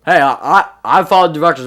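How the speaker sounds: loud, fed-up phone plea; exasperated call. Retold or imitated. Caller to the guy on the phone, loud and fed up. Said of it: "I'd be like".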